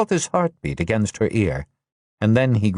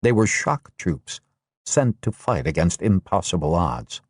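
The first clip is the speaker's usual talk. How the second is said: usual speaking voice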